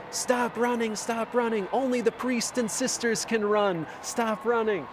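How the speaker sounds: high-pitched mock yell, no real volume; imitated guards' yell. Guards yelling at runners to stop, high imitated yell. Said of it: "some of the guards yelled out to me".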